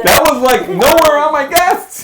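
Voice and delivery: high, loud laughing protest; cracking up. Real, not acted. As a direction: squeal it through the laughter. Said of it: "cracking up at the answer".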